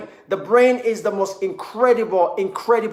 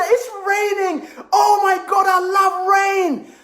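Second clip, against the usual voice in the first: giddy, pitched way up with delight; gushing joy; 10 semitones higher, slower than usual. Pretend. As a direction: squeal with over-the-top happiness. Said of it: "and you say".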